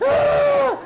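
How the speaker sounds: high laughing yell; hammed-up yelp; laughing shout. Shown as a demonstration. Mock pushy witnessing.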